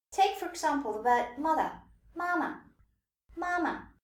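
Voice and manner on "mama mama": "mama" high first syllable, light short second; light, short neutral tone. Shown as a demonstration. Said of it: "light and short".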